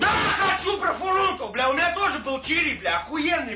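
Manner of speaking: sarcastic shout. Real, not acted. Mock-eager shouting about a boil.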